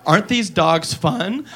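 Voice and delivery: put-on slow, soft singsong kid; dubbed ad girl cooing, slow and soft; sweet little girl. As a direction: coo it like a cute ad child. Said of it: "now she goes".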